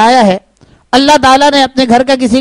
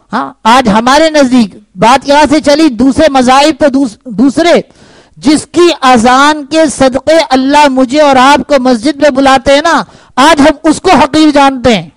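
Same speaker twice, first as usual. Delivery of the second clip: ordinary delivery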